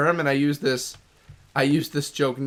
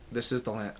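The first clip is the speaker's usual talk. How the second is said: ordinary talk, nothing marked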